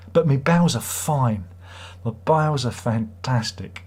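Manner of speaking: normal talking voice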